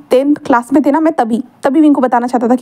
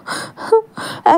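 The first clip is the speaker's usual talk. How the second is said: sob; 9 semitones higher, 5 dB quieter than usual. A narrator's reading. Crying scene peak.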